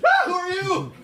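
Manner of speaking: high, loud mock startled shout; hammed-up shock at a stranger; startled old man.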